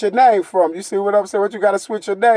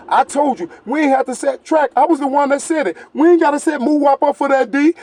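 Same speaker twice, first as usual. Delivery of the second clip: mocking quote of the rival; 8 semitones higher than usual. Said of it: "He said".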